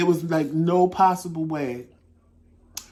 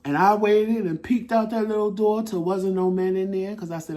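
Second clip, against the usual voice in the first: ordinary delivery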